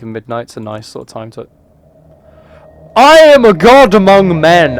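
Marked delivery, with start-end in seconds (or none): 2.9-4.8 loud, slow mock proclamation; hammed-up godlike shout; grand boast. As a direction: declare it like a deity.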